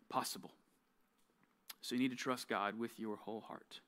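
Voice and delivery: low, soft, sincere devotional reading; quiet devotional. Acted. Youth speaker opening the devotion to listeners, soft and sincere. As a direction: speak it softly, like a prayerful reassurance.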